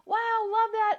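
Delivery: high, loud delighted exclamation; acted excited reaction. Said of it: "all these things go".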